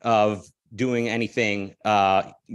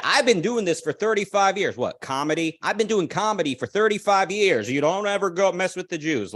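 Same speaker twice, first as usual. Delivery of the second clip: higher-pitched mock imitation of a comedian; mocking paraphrase; 7 semitones higher than usual. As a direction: parrot the comedian's lines, pitched up.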